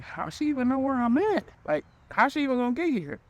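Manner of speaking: imitating a freaked-out how-is-she-coming; high, incredulous questioning; alarmed disbelief. Alarmed asides about her coming.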